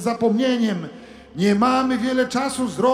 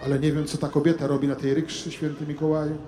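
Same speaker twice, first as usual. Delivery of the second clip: muttered much softer and lower, half to himself; quiet aside; 6 semitones lower, 10 dB quieter, faster than usual. Acted. Priest muttering to Santa on the street, hushed and low. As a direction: mumble it under your breath, quick.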